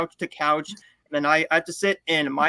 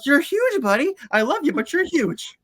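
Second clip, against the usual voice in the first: higher, affectionate owner voice; fond talk to dog; 9 semitones higher than usual. Retold or imitated. Owner telling his heavy dog he is too big, higher and fond. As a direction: tease your huge dog lovingly. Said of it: "And I'm like".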